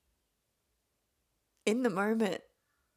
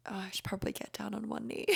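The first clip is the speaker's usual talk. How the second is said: much softer, fast, breathy whisper; whispered aside; 9 dB quieter, much faster than usual. Retold or imitated. Boyfriend murmuring to himself before kneeling, whispered.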